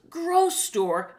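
high, loud puzzled cartoon monster; mock a dim puppet's confused question; hammed-up dopey creature voice; dumb monster. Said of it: "the dumb monster hears grocery store and goes".

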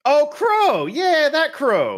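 high, loud, delighted; excited recognition. Real, not acted. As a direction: suddenly get it and cheer. Sudden recognition of the art.

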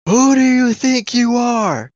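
high, drawn-out indignant screech; imitated screaming outrage. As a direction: screech it slowly, mocking an outraged woman. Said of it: "screaming at me".

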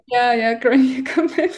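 laughing through her account name; a shy laugh breaking into the words. Real, not acted. Guest names her account to the host, laughing. Laughing as she says handle.

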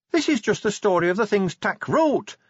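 high-pitched voiced aside to a superior; whispered aside. A narrator's reading. Cheery confides in Vimes, a high-voiced stage aside. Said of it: "Cheery whispered to Vimes".

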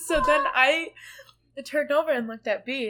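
normal talking voice

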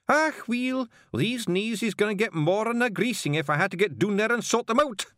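high, loud Scots snarl; snarling Feegle. Acted. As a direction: threaten your own knees. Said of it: "snarled the hairy man".

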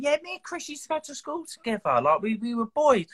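high, put-on Scouse mate voice; mimicking an old school friend; imitating Jason's Scouse. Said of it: "he went".

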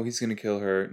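usual speaking voice

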